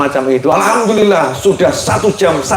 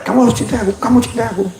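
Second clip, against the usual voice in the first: high, pressing demand, mock insistence; a lightly voiced pushy suitor demanding love; pushy lover; 7 semitones higher, faster than usual. Forcing someone to love you.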